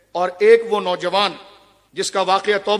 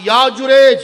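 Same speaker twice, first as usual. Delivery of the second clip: mother calling out; 4 semitones higher, 6 dB louder, slower than usual. Retold or imitated. Mother calling son from below.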